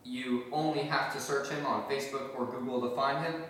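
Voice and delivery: ordinary talk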